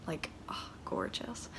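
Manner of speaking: breathy whispered gush over the hair; whispered aside. Real, not acted. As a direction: drop to a whisper and swoon. Gushing aside about blue hair.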